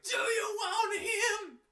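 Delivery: very high, drawn-out screech; loud, slow, shrieked song lyric; insane screaming. Retold or imitated. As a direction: imitate a singer's unhinged, pained scream. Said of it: "he's just screaming like an insane person".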